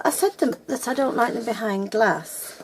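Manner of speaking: usual speaking voice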